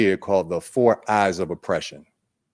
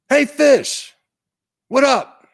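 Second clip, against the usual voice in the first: much higher, louder playful fox voice; cool fox greeting; 5 dB louder, slower than usual. Acted. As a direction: call out to the fish like a breezy cartoon fox. Fox greets fish again.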